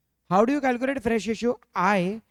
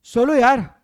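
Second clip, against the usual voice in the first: loud exasperated call; 2 semitones higher, 9 dB louder than usual. Real, not acted. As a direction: call it out, fed up. Teacher chides silent class.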